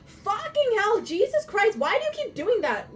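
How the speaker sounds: furious high shout at the phone; angry yelling. Real, not acted. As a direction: yell in real frustration.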